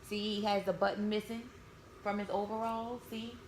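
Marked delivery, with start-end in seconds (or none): none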